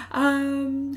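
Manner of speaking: normal talking voice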